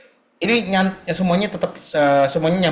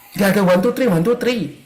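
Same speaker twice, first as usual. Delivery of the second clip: ordinary delivery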